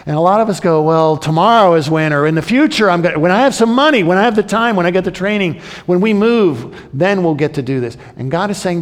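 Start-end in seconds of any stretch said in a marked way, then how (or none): 0.8-8.0 higher, louder, rushed whiny excuses; mock excuse-maker. Preacher mimicking churchgoers putting things off, higher and louder. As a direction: ham up the procrastinator.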